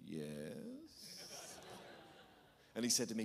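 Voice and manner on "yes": small, low, sheepish yes; barely there confession; quiet admission. Retold or imitated. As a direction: admit it under your breath. Sheepish admission to rival fan.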